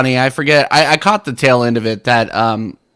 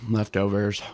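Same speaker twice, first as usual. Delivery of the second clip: quiet; 5 semitones lower, 9 dB quieter than usual. Real, not acted. Repeated to himself.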